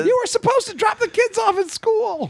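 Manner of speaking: put-on high-pitched nagging girlfriend; mock girlfriend. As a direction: needle him like a fed-up partner. Voicing the angry girlfriend.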